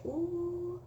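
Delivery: high, sugary mock-sweet voice; sassy sweetie brush-off. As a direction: sweetly show them the door. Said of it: "I say".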